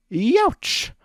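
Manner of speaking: high, loud yelp of pain. Acted. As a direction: yelp as the blade hits. Discord hit by sword.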